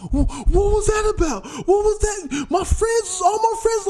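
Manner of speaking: high, indignant shout; upset teen. Retold or imitated. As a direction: complain like an embarrassed teen. Daughter retold, upset at dad.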